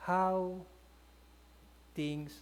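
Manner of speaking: usual speaking voice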